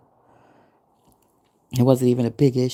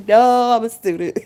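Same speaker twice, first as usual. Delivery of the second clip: high, louder laughing insistence; insistent protest; 8 semitones higher, 6 dB louder than usual. Retold or imitated. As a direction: insist again, higher and louder.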